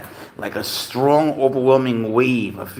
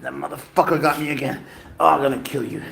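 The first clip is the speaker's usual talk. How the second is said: normal talking voice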